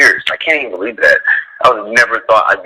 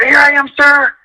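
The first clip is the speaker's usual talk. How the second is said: high, eager reply; eager runner. Retold or imitated. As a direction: jump to attention up high. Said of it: "I'm like".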